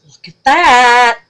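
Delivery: loud excited repeat after the whisper; excited exclaim. Real, not acted. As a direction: belt "Look at that" with delight. Hushed then loud marvel.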